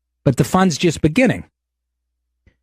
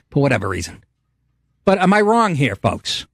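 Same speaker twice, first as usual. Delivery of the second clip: usual speaking voice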